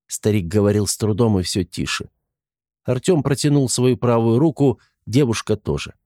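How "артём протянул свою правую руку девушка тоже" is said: ordinary delivery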